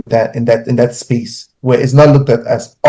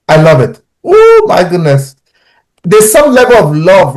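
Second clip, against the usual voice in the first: delighted; 5 semitones higher, 3 dB louder than usual. Real, not acted. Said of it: "delighted about daughter".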